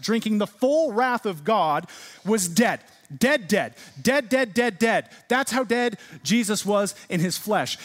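loud, high preaching with drawn-out key words; impassioned preaching. Real, not acted. Preacher driving home the resurrection to his church, impassioned preaching.

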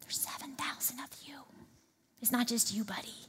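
soft breathy whisper, tender and close; gentle whisper. Retold or imitated. God gently reassuring tired Elijah, soft whisper. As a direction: whisper it gently like a comforting friend. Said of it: "He just gently says".

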